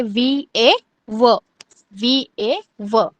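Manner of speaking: वी ए व in long, separate beats; spelled-out pronunciation. Shown as a demonstration. Teacher showing pupils how to pronounce व, spelled out slowly. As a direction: slowly spell वी, ए, then sound व. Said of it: "pronounce करना है".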